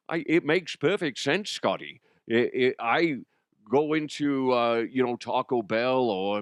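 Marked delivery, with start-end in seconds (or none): 0.1-2.0 lightly put-on Scottish film-star voice; Sean Connery. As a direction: answer with a hint of Scottish burr. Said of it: "where you, Connery, play a Spaniard".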